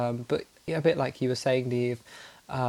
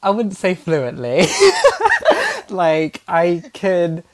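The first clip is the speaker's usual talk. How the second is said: slow, laughing modest denial; 5 semitones higher, 9 dB louder, slower than usual. Real, not acted. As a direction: laugh while you play it down. Laughs off question on fluency.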